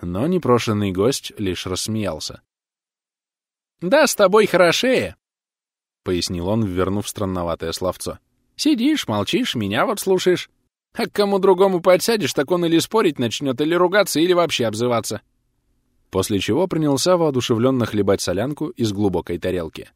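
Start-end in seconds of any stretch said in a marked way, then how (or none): 3.8-5.2 put-on high, louder old-pal voice; raised, eager character voice; chatty neighbour. A narrator's reading. Chatty neighbour answering the grumpy pensioner. 8.5-15.2 high-pitched, eager talker; higher voice, listing complaints about others; chatty neighbour. A narrator's reading. Chatty neighbour explains himself.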